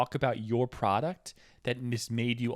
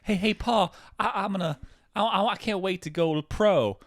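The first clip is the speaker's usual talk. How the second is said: put-on kid voice; 6 semitones higher, 3 dB louder than usual. Kid voiced in acted skit.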